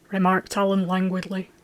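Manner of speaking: ordinary delivery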